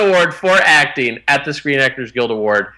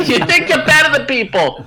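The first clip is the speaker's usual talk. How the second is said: put-on Boston accent; 12 semitones higher than usual. Boston accent impression bit.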